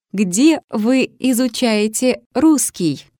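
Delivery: usual speaking voice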